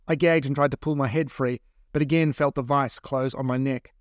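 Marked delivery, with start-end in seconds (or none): none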